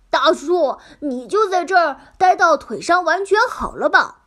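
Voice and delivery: loud caring worried plea. Acted. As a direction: urge him to stay, anxiously. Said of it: "一脸担忧地说".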